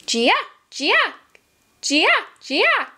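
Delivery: high chattering monkey call; playful high monkey squeaks. Acted. Monkey chatter in picture book.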